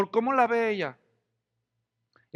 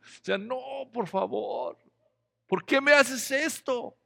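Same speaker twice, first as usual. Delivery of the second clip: mock plea; 4 semitones higher, slower than usual. Pleading to God at mirror.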